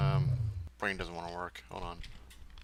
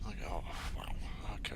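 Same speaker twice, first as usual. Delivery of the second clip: deflated whisper; 2 semitones lower, 6 dB quieter, much faster than usual. Real, not acted. After crash, deflated.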